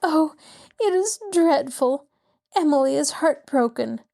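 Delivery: an upset elegant widow pleading with the lawyer; distressed society lady. A narrator's reading. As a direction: plead with distress, voice lifted high. Distressed Mrs. Willoughby voiced higher.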